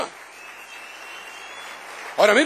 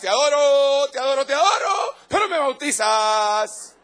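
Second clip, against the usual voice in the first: mocking prayer; 9 semitones higher than usual. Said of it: "Esa no es adoración".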